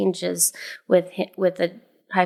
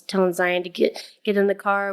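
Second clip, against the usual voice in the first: usual speaking voice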